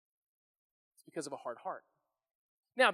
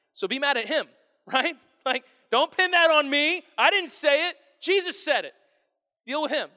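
higher, louder, playfully indignant; mock defiant; 6 semitones higher, 7 dB louder than usual. Pastor acting out a cheeky reply to skeptics, mock defiant. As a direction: toss it off like it's not your fault, laughing.

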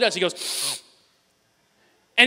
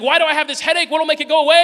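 put-on higher, louder complaining kid; whiny child; 6 semitones higher, 8 dB louder than usual. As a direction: whine like a sick child wanting relief. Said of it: "he's like".

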